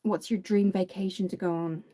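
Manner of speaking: usual speaking voice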